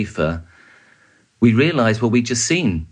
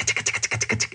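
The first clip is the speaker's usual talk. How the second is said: soft whispered ticks like background gunfire; imitated gunfire ticks; 7 dB quieter than usual. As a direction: mimic the far-off gunfire under your breath. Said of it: "you could hear the tick".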